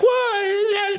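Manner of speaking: very high falsetto yelp; hammed-up high squeal of alarm; mock startled cry. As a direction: cry out like an alarmed little gnome. Gnomes run out crying out.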